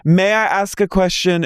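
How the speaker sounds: high mock plea to speak; imitating a pleading castmate. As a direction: beg to be heard, high and thin. Mimicking Wild Rose.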